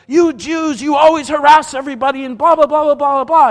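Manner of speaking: imitated angry rant, loud and high; high, loud mock tirade; yelling and screaming. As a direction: yell fast, trailing into mocking blah-blahs. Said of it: "starts yelling and screaming at him".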